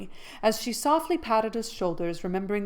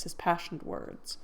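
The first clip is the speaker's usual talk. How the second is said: plain reading voice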